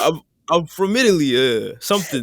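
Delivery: a hint of a bad Italian accent. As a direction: put on a lame Italian accent. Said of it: "what anybody with from Italy sounds like".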